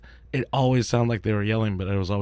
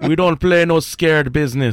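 put-on slow Jamaican accent, a touch louder; Belly movie quote; 5 semitones higher, 6 dB louder, slower than usual. Quoting the movie Belly to co-hosts in a Jamaican accent, slow. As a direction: lean into a Jamaican gangster line, slow. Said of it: "you've seen Belly".

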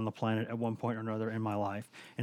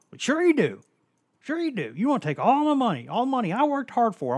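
much higher, louder mocking coach voice; imitating the coach; 11 semitones higher, 7 dB louder than usual. Coach Dooley accusing the liberal reporter, high mocking squeal. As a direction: squeal it like an outraged rich man.

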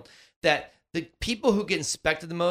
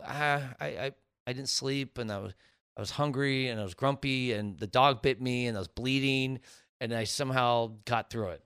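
mock excuses; 5 semitones lower, 6 dB quieter than usual.

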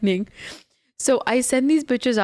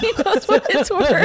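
laughing; 8 semitones higher than usual. Real, not acted. Friends teasing while laughing.

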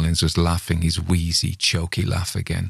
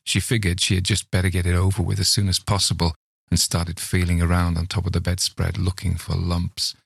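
usual speaking voice

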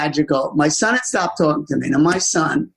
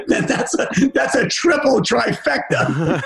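laughing; 6 semitones higher, slower than usual. Real, not acted. Joke about dual addiction.

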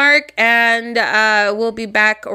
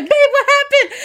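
high-pitched, panicked imitation through laughter; alarmed partner; 4 dB louder than usual. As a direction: act out a freaked-out partner.

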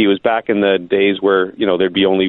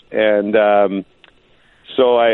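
normal talking voice

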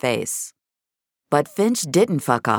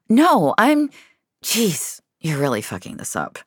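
flustered; 6 semitones higher than usual. A narrator's reading. Flustered, scolding herself.

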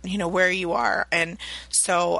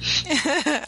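laughing; 9 semitones higher than usual. Real, not acted. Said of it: "That was awesome".

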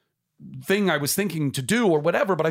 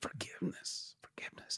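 soft reverent whisper; hushed, savoring the word; 20 dB quieter than usual. Real, not acted. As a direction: barely breathe the word.

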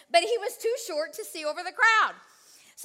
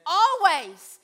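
louder ringing always; emphatic always; 3 semitones higher, 8 dB louder, much slower than usual. Real, not acted. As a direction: declare it with force. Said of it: "always cause us to triumph".

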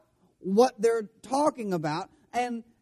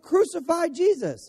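mock crowd yell; 8 semitones higher than usual. Preacher voicing the crowd that yelled, mock shout. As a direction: shout it like the mob demanding crucifixion. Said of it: "people who yelled".

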